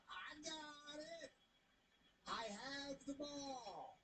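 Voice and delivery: slow, soft, hushed smug drawl; coy, hoarding the ball for himself; smug player voice. Retold or imitated. Maile voiced guarding the ball.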